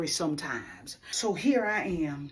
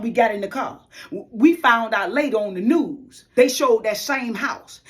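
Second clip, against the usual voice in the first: ordinary delivery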